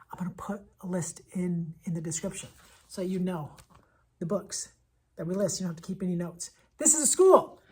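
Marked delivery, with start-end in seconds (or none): 0.1-6.5 hushed, half-whispered side note; quiet aside to the viewer. Real, not acted. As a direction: drop to a confiding whisper. Quiet aside about the book list.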